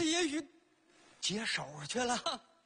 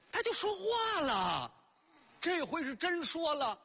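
excited insistence; 7 semitones higher than usual. Acted. Old man insisting to Judge Bao the basin spoke, excited. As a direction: blurt it out, desperate to be believed.

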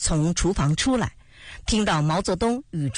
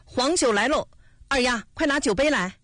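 higher, narrated hawker-like call; mild calling out; 5 semitones higher than usual. A narrator's reading. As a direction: call out lightly that the wine is here. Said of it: "嘴上吆喝着".

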